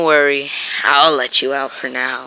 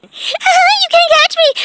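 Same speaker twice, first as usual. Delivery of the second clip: high slow shouted taunt; high shouting playground taunt; taunting squeal; 8 dB louder, slower than usual. Acted. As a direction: squeal it out mockingly. Taunting chase.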